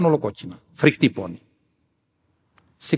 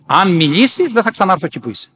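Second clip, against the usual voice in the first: raised, louder imitation of a warning; urgent warning; 5 semitones higher, 4 dB louder than usual. Elder warning the nun to keep silent, urgent. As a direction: warn firmly, pitched up and forceful.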